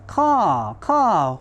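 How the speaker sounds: high cawing crow call. Acted. Crow greets the bear with a cawing call. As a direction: caw like a crow.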